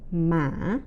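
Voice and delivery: "mã." dipping low, then rising as if asking. Shown as a demonstration. Teacher showing the fifth tone, low rising. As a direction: start "mã." low and lift it like a question. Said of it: "asking or low rising tone".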